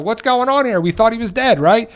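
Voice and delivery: imitating shocked viewers, pitched high; a playful high-pitched "wait, what?"; mock disbelief. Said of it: "We're like".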